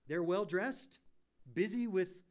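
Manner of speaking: loud, rising, impassioned pulpit voice; preaching at full force. Acted. Pastor warning his church about empty lives, preaching loud and impassioned. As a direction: drive it home to the congregation.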